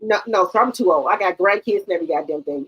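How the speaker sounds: usual speaking voice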